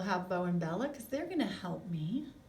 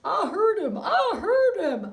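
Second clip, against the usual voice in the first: a higher, louder puppet voice; 9 semitones higher, 11 dB louder than usual. Acted. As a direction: squeak it out as the little puppet.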